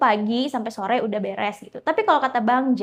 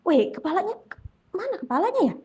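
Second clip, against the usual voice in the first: high, bewildered mumble; lost and befuddled, searching for words; dazed confusion; 7 semitones higher than usual. Retold or imitated. Bang Jack stunned after the ghost vanishes, dazed and high.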